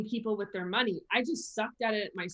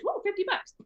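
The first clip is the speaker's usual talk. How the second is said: high-pitched little whoa of wonder; lightly acted amazement, pitched high; mild mock surprise; 10 semitones higher than usual. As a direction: act pleasantly astonished at a sudden find. Acts out money suddenly turning up.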